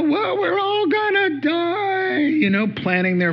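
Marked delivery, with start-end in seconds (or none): 0.0-2.2 high, slow, drawn-out wail of doom; overdone panicky lament, stretched out; mock despair. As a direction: wail it like a hammed-up doomsayer. Acts disciples despairing in the storm.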